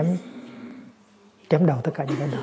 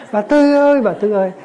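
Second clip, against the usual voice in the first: bellowed, much higher call; roaring call; 7 semitones higher, 8 dB louder than usual. Retold or imitated. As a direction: yell her name at the top of your lungs. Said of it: "hai bên cứ rống lên".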